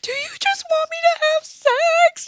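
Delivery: high mock voice of mom; quoting mom in a high put-on voice. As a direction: voice the mother high and pointed. Daughter voicing mom's challenge.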